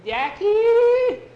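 very high, loud scream; panicked squeal of a name; terrified cry. Acted. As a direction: shriek for your friend in horror. Boy touches hair in the dark.

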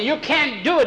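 put-on very high, loud boyish cry; desperate protest. Boy knocking the gun away, high desperate cry. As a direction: cry out to stop the shot. Boy stops man shooting dog.

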